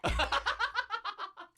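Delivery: high burst of laughter. Real, not acted. Laughing at the story.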